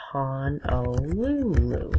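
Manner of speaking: Honolulu drawn out, hon-o-LOO-loo; careful pronunciation. Shown as a demonstration. Teacher models a capital's name.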